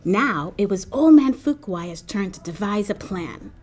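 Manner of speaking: ordinary delivery, nothing marked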